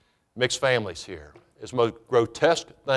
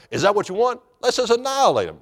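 higher-pitched acted plea of zealous disciples; mock eagerness; 6 semitones higher, faster than usual. Acting out the vengeful disciples' plea.

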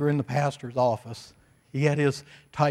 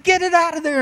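high, loud imitated scream; yelling and screaming; 14 semitones higher, 5 dB louder than usual. Pastor acting out the hippie demanding help, screaming. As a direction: yell it high, like someone frantic. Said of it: "he was yelling and screaming".

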